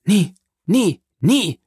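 emphatic, repeated refusal, each word punched; crying out. A narrator's reading. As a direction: exclaim it in separate, rising bursts. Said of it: "rief die Marquise".

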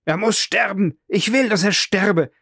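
high, frantic screaming demand; frantic outcry. Acted. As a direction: scream it while wrestling in the dust. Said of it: "fortwährend ausrief". (hoch und rasend geschrien, im Staub ringend)

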